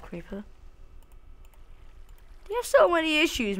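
normal talking voice

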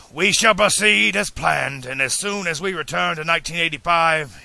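high, loud proclamation; resolute. A narrator's reading. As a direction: announce the final decision with force. Doc announces his painful decision.

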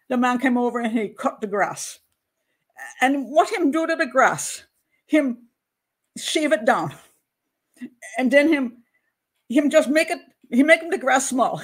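emphatic, badly faked Jamaican accent. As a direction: retell it as a Jamaican mother-in-law's tale. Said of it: "So sorry for the accent".

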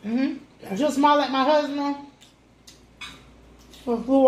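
chewing between words; eating fries while talking; mouth full. Real, not acted. Seen 2: She bites fries at +0.1, then talks with food in mouth.

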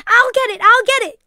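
very high, loud shouting; urgent yell. A narrator's reading. Lisa heads off the helping ladies, yelling. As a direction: yell it in a panic to get there first. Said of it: "Lisa yelled as several ladies".

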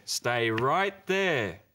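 very slow, drawn-out order; slow firm command. Acted. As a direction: hold the cow still word by word. Farmer tells cow to stay.

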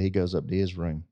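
ordinary delivery

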